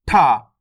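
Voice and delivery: ठा released with a burst of air; forceful aspiration. Shown as a demonstration. Teacher shows learners the forced letter, ठा strongly aspirated. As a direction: push the consonant of ठा hard. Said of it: "ठा को जोड़ लगा के पढ़ देंगे".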